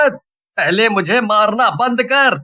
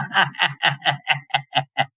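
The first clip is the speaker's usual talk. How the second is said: low snickering laugh; mocking laugh; 6 semitones lower, 7 dB quieter than usual. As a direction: snicker at the neighbour. Birju laughs at neighbour. (पड़ोसी पर बिरजू की हँसी)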